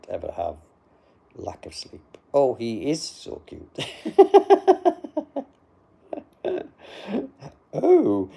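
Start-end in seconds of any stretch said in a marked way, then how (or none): 3.8-5.4 high giggle. Real, not acted. Reacting to a flattering comment.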